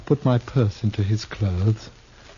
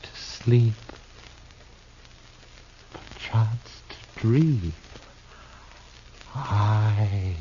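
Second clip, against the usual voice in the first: dying recitation; slower than usual. Acted. Dying man recites Hamlet.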